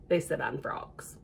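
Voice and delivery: whispered punchline. Pretend. Reader whispers the punchline to the children. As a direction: drop to a secret whisper.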